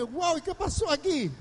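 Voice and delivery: high-pitched mock astonishment; stunned. Onlooker reacts to sudden money quarrel, stunned.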